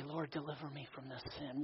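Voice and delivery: soft murmured plea to God; hushed prayer. Acted. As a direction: pray it quietly, almost to yourself. Voicing a prayer.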